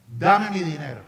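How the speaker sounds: loud, barked demand; demanding yell. Retold or imitated. Samir demanding his money back, loud yell. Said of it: "está vociferando mientras tanto".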